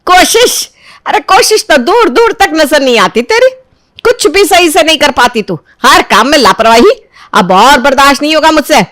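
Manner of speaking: scoff, then tear into her; scornful shouting. Acted. Mother-in-law mocks and berates.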